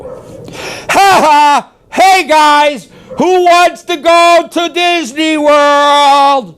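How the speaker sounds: high, booming showman's holler; yelling. Shown as a demonstration. Trainer yells at humans to show dogs won't flinch, loud high yell. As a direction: yell it out, drawing out the last words. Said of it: "Watch when I yell".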